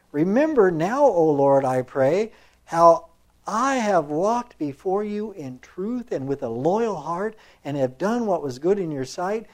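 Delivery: loud, emphatic reading of a king's prayer; scripture reading. A narrator's reading. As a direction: proclaim the verse with weight, stretching key words. Reads scripture prayer aloud.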